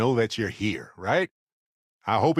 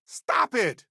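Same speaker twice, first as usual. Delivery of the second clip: exasperated; 12 semitones higher than usual. Acted. Exasperated outburst.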